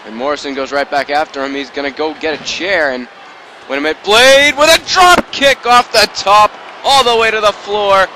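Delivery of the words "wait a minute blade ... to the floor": high, loud rising shout; excited call. Real, not acted. Commentator calling a top-rope dive to viewers, loud and thrilled. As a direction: build the call as he flies.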